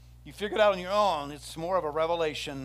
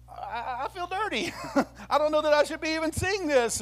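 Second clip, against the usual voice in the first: mock scandalized disciple; 11 semitones higher, 5 dB louder than usual. Disciples shocked by pagan rite.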